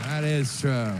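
low-pitched imitation of won-over passengers; grudging agreement. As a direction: concede the point, voice dropped low. Passengers keep conceding the point.

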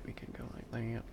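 breathy whisper of We can go like that. Real, not acted. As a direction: drop to a private whisper. Repeats line, then whispers it.

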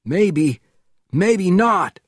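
out of breath while running; breathless. A narrator's reading. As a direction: gasp the words out. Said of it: "replied Conklin breathlessly".

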